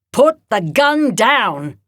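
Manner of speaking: slow, loud, firm order; firm command. Acted. Edna ordering Talon to disarm, slow and firm. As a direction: lay each word down heavy and firm.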